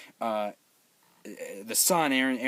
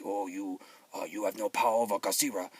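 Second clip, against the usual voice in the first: imitated Japanese accent, sage-like; Ken Watanabe; 3 semitones lower than usual. Japanese sage warning about Godzilla, imitated Japanese accent. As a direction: put on a Japanese accent, quick on the last words. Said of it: "he literally talked like this".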